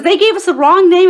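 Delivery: high, loud outburst; overexcited spiel. Retold or imitated. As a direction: blurt it out in excited disbelief. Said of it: "went into this whole spiel".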